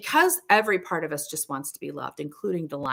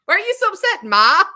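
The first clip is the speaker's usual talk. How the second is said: put-on much higher, louder teen whine; teen son; 13 semitones higher, 8 dB louder than usual. Seventeen-year-old son teasing his mom, much higher and louder. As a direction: tease mom like a shrugging teenager. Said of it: "He'll say".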